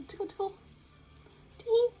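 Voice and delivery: light tickling voice; playful tickle. Acted. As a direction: coo it playfully. Octopus tickling children.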